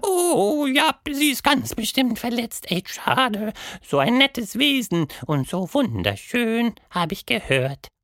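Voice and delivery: very high, fussy mock-wounded voice; milk the drama of being hurt; high theatrical moaning, ironic; groaning creature. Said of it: "stöhnte".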